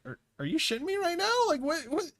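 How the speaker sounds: retelling the coworker's stunned disbelief; incredulous. Retold or imitated. Coworker reacting to being misheard, fast high disbelief.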